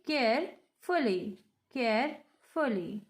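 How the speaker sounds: CAREFULLY broken in two, care-fully, with a pause between; split into syllables. Shown as a demonstration. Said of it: "We have two syllables".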